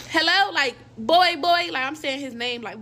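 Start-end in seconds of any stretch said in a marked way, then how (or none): 0.1-0.5 higher, louder "Hello?" down the phone; retold calling into a silent phone, louder and higher. 1.0-1.7 higher, louder "Hello?" down the phone; retold calling into a silent phone, louder and higher. Calling his name on phone.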